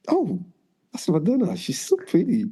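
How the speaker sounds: starstruck awe. Retold or imitated. First sight of [name].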